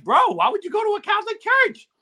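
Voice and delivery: retold incredulity. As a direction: act out baffled disbelief, pitch shooting way up. Retold shocked reaction to friend's church.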